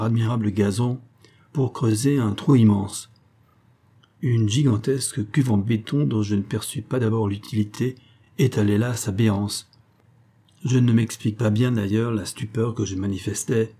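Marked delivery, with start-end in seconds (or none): none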